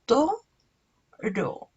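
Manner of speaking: "Toro" in two beats with a long pause between; syllable by syllable. Shown as a demonstration. Teacher counting syllables on fingers, "Toro" syllable by syllable. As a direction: split "Toro" into to, then ro. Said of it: "Hagámoslo con dedos".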